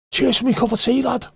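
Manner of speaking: high, loud Scouse shout; mock aggressive. Liverpudlian saying something nice, mock aggressive. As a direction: make nice words sound like a threat. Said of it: "everyone sounds aggressive".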